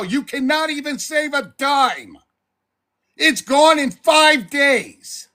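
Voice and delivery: furious, venting at a viewer who wastes money; angry rant. Real, not acted. Livestreamer ranting at a viewer who begs for money, furious shout.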